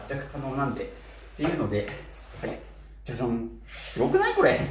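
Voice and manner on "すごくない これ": excited. Real, not acted. Excited showing off coat.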